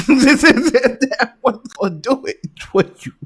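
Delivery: high, slow words through real laughter; helpless laugh pushing the pitch up. Real, not acted. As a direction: crack up while talking. Cracking up at the tape.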